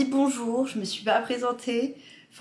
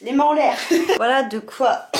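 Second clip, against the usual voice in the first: laughing; 4 semitones higher, 7 dB louder than usual. Real, not acted. Spraying, laughing, shouting playfully.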